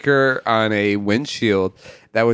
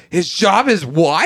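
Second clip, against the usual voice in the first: mock shocked; 6 semitones higher, slower than usual. Tree voiced, shocked.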